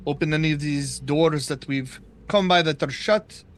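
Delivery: ordinary talk, nothing marked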